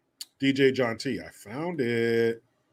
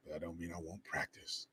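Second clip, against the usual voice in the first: sly murmur; 8 semitones lower, 20 dB quieter than usual. Acted. Sly joke aside.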